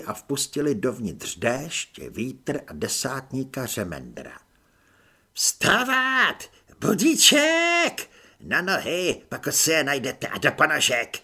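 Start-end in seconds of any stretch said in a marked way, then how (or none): 5.3-11.2 high, loud barked reveille; corporal's wake-up shout. Acted. Corporal Řemendr yelling recruits out of bed, loud and high. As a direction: roar the barracks awake, drawing out Budíček.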